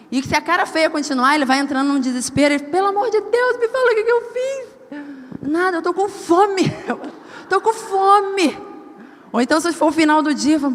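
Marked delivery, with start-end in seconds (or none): 2.6-5.5 much higher, slow pleading whine; a mock frantic husband begging to know; imitating desperate husband. Marido desesperado imitado. 5.5-8.6 higher, laughing through the words; laughing reply. Retold or imitated. Ela responde rindo.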